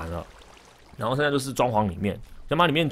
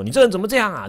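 mock scolding; 8 semitones higher, 4 dB louder, faster than usual. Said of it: "就是骂，骂，骂".

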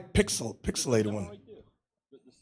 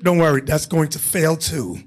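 ordinary talk, nothing marked

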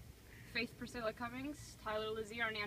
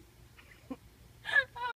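nervous laugh; 6 dB louder than usual. Real, not acted. Nervous laugh before the dump.

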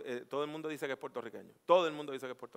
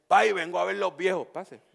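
put-on Puerto Rican accent; 3 semitones higher, 10 dB louder than usual. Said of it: "aprendí que ... en Puerto Rico".

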